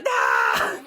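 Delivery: low shouted cry for help; girl calling for mom. Acted. Girl grabbed by the blanket shouts for her mom. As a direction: yell for mom in panic. Blanket grabs girl's leg.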